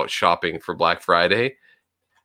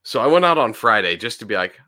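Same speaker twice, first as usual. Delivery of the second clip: normal talking voice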